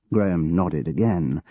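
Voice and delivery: ordinary delivery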